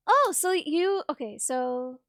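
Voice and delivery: normal talking voice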